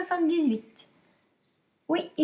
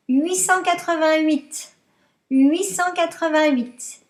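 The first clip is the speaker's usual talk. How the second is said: dictating; 5 dB louder than usual. Shown as a demonstration. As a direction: read each number out for pupils to write. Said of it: "je vous dicte".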